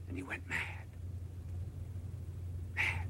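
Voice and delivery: dropped to a near whisper; hushed. Acted. Preacher telling that Nietzsche went mad, hushed. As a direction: let the word fall away, barely voiced. Repeated word dropped to hush.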